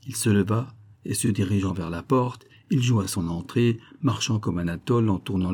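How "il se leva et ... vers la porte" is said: plain reading voice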